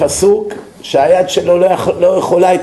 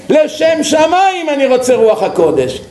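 pleading shout; 6 semitones higher than usual. Acted. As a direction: cry out the prayer at full voice. Thief begs God for holy spirit.

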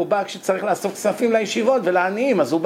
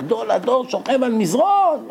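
mild mock shout; 6 semitones higher than usual. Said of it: "אז הוא התחיל לצעוק".

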